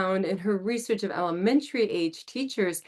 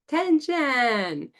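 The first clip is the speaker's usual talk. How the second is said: stretched, higher finishing word; drawn-out attention to finish the prompt; teacher completing the class's phrase; slow completion; 5 semitones higher, 3 dB louder, much slower than usual. Shown as a demonstration. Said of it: "And then I finish it".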